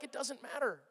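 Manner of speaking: soft, offhand reply after the fuss. Acted. You answering the fretting walker, quietly dismissive. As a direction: wave the worry away quietly.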